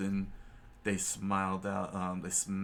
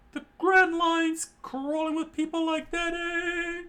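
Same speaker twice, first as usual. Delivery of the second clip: loud, high-pitched screech of disbelief; shocked outcry; 6 dB louder than usual. A narrator's reading. Cook in shock at the rubber boy, high screech. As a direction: cry it out high, stunned.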